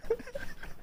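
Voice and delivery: breathy laugh. Real, not acted. Laughing at own joke.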